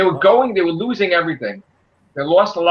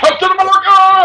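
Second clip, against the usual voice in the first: high, loud starstruck cry; mock hero cry; 7 dB louder than usual. Friend mocking a fanboy teen, shouting high. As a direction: yell it like an excited kid.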